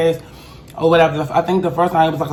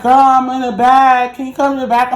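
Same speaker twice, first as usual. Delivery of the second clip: higher-pitched imitation of the girlfriend; friend's voice; 7 semitones higher, 4 dB louder than usual. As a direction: mimic the friend's high, easy reply. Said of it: "She's like".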